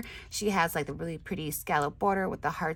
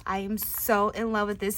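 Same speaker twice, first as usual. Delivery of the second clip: usual speaking voice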